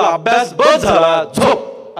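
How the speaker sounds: slow, emphatic parent imitation; parental bedtime order. Parents sending a studying child to bed, firm imitation. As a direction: draw it out firmly like a parent.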